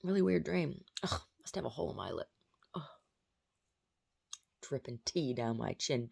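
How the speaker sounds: ordinary delivery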